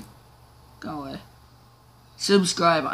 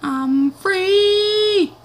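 gleeful squeal; much slower than usual. Pretend. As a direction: shriek with joy like a kid. Joyride in game.

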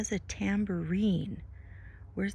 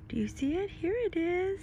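usual speaking voice

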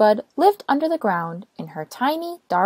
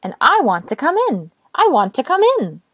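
cheery little voice; 6 semitones higher, 3 dB louder than usual. Acted. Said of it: "said the cheery little voice".

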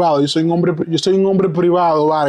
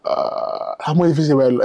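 normal talking voice

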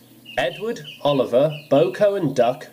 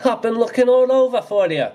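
high, loud happy greeting; light up at finding her; beaming; 10 semitones higher, 3 dB louder, faster than usual. Acted. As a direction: Donald finding his lost duck, beaming. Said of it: "Donald beamed".

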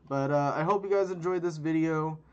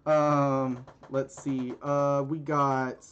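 ordinary talk, nothing marked

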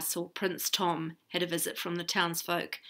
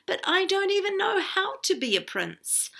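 much higher, flustered protest; crying out in dismay; 12 semitones higher than usual. A narrator's reading. Shepherd protesting to the princess, crying out. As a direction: voice jumps up in alarm. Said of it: "cried Tom".

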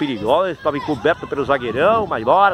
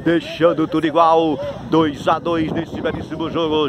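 drawn-out, deliberate announcing; slow celebratory sportscaster delivery; slow post-goal call; slower than usual. Real, not acted. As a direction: stretch every phrase after the goal. Commentator winds down after goal.

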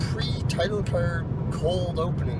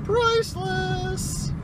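exaggerated exclamation; much slower than usual. Mock exclamation after the story.

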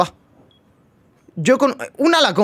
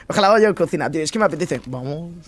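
ordinary talk, nothing marked

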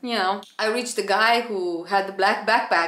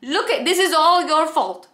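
scolding; 5 semitones higher, 4 dB louder than usual. Retold or imitated. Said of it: "I was like".

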